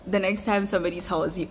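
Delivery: normal talking voice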